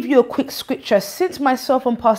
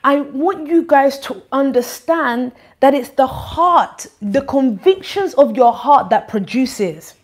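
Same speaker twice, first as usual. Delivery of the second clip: louder, higher exhortation from the pulpit; preaching; 5 semitones higher, 5 dB louder than usual. Acted. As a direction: press it home to the congregation. Exhortation at raised force.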